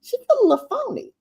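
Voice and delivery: high-pitched mock gossip; imitated doubters. Imitating people who distrust her, high mock judgement. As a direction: voice the sceptics, pitched well up. Voicing what suspicious people say.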